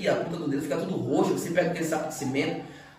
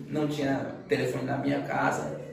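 normal talking voice

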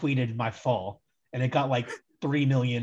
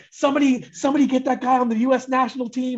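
excited fan call; 8 semitones higher, 4 dB louder than usual. Retold or imitated. Online fans calling for him.

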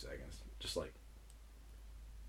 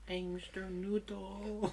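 whiny student voice; 10 semitones higher, 9 dB quieter, slower than usual. Retold or imitated. Echoing the student voice.

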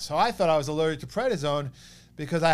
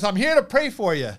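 raised and louder, a kindly rabbi announcing himself; higher, louder visiting rabbi; rabbi's voice; 6 semitones higher, 8 dB louder than usual. Retold or imitated. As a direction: play the rabbi bursting in to pray. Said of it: "and he goes".

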